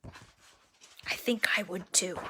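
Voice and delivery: slow confiding whisper. Acted. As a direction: whisper it as a playful confession. Confiding aside to child.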